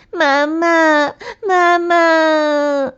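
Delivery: put-on high, drawn-out child's wail; little rabbit wailing for mum; acted bawling as you run home to mother; crying. A narrator's reading. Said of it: "小兔哭着回家了".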